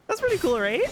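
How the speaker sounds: very high, loud, strained with laughter; high-pitched, laughter bursting through; about to crack up. Real, not acted. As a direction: squeak it out just before laughing. Barely holding in laughter at mishap.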